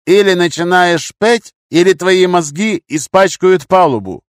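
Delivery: high, slow, forceful menace; loud threat. A narrator's reading. Gunman with pistol at captive's throat, loud menace. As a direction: press the threat loudly and deliberately.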